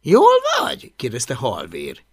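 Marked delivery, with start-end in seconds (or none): 0.0-0.9 high, louder query; concerned friend. Acted. Friend checks on bitten boy, concerned. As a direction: ask in a much higher voice.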